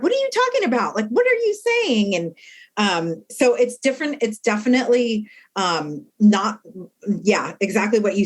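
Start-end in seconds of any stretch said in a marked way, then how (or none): 0.0-2.1 much higher baffled question; baffled inmates. Retold or imitated. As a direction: play the confused inmates. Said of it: "they're like".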